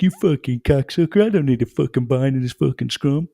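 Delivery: high, loud mock rant at a teammate; aggro rugby forward. Second row barking at his prop, high and loud mock fury. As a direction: ham up a raging forward refusing help in the scrum.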